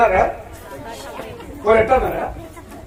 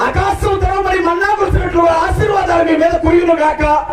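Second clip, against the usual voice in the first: preacher calling blessing down on the church; shouted blessing; 8 semitones higher than usual. Real, not acted. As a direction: declare the blessing at full voice.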